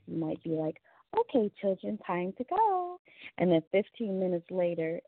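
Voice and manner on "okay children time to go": sweet sing-song nanny, rising very high on go; lilting Mary Poppins call to the kids. Retold or imitated. Mom calling the kids in a sweet nanny voice. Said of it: "in a Mary Poppins voice".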